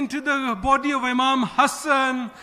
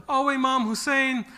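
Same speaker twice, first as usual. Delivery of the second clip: usual speaking voice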